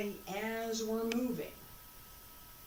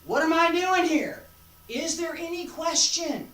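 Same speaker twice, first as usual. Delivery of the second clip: emphatic question; 9 semitones higher, 9 dB louder than usual. Shown as a demonstration. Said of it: "There shouldn't be".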